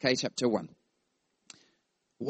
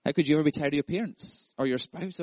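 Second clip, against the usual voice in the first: normal talking voice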